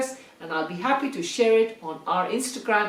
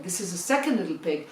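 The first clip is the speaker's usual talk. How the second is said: plain reading voice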